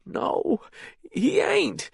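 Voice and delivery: put-on high, tearful, breaking old man voice; frightened old prisoner, close to crying; near tears. A narrator's reading. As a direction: answer on the verge of tears. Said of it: "on the verge of tears".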